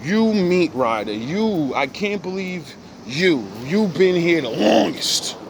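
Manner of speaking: slow, high mock outrage; calling out a meat-rider friend; disgusted disbelief. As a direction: drag it out in betrayed disbelief. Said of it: "And I'm like".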